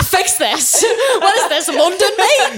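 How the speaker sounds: a cheeky London voice mocking the dentist; mock British accent. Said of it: "That's her talking to her dentist".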